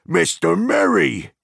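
high, loud, slow cry of surprise; astonished cry. A narrator's reading. Farmer recognising Merry in lantern light, a loud astonished cry. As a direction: cry out the name in disbelief. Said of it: "he cried".

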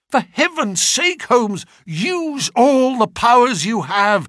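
high-pitched, impassioned appeal; acted agitated begging; desperate plea. A narrator's reading. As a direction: implore with mounting urgency. Distraught friend begs the detective for help.

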